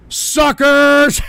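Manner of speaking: high, loud, drawn-out taunt; gloating yell. Acted. Trader to the fooled investors, slow gloating yell. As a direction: stretch it out as a jeering shout.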